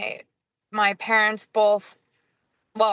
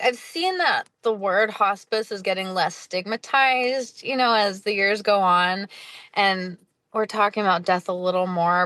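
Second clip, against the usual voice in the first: ordinary talk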